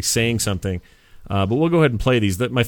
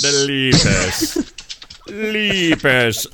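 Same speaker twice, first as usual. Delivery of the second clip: put-on old sci-fi announcer; 3 semitones higher, much slower than usual. Echoing the trailer title dramatically.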